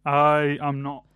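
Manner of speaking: ordinary talk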